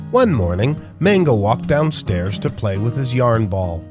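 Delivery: ordinary delivery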